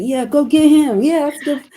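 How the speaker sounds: high, loud mocking cheer. Host mocks the hospital staff cheering her plan on, high sarcastic cheer. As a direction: sarcastically play the eager staff.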